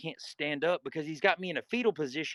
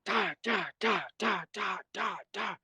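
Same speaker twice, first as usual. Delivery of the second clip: rapid repeated chomping noises, pitched up; imitated biting noises; 6 semitones higher than usual. Inmate mimicking bites on their back, imitated chomping. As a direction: mimic quick bites with higher, punchy syllables. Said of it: "He starts biting my fucking back".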